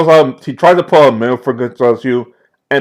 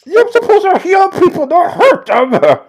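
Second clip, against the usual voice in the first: high-pitched outrage. Acted. Captive pleads with torturer.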